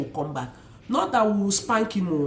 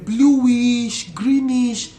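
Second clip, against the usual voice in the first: excited; 4 semitones higher, 7 dB louder, much slower than usual. Real, not acted. Admiring children's eyes.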